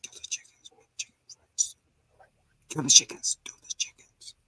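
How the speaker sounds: soft unvoiced whisper; eerie whispering voices. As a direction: hiss it like voices in his head. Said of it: "You hear the voices".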